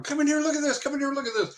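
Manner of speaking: high-pitched excited call to wife; retold yell. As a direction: call someone in urgently, pitch way up. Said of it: "And I yell at my wife".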